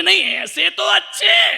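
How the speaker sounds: high, loud, slow whining sing-song; hammed-up wife. Wife answering the monk in a high hammed-up whine. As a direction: whine it theatrically, drawn out.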